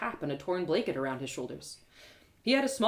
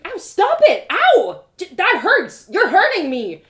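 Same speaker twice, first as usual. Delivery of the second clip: much higher, louder cries of pain; 11 semitones higher, 14 dB louder, slower than usual. Acted. Narrator cries out while guard jabs her, pained. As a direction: yelp as the spear jabs you. Hurt by spear prodding.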